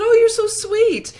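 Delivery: high, drawn-out imitated sweetness; loud, very high-pitched cooing gratitude; gushing thanks. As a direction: imitate a touched woman, high and slow. Retold gushing thanks from singer.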